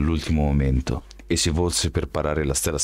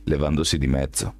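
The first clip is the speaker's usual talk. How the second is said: plain reading voice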